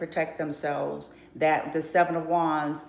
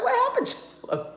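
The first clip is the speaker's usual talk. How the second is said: mock high worried voice; much faster than usual.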